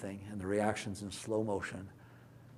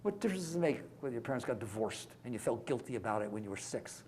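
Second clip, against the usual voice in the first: ordinary talk, nothing marked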